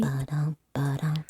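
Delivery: soft whispered rhythm; whispered beat. Pretend.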